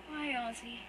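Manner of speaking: soft, hurt whimper; barely getting the words out through tears. Acted. Wounded brother asks why, in a soft whimper. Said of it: "he whimpers".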